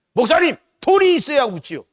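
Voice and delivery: high, loud whining excuse; hammed-up congregant pleading money first; mock whiny complaint.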